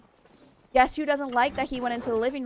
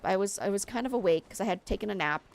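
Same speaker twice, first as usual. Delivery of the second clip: ordinary talk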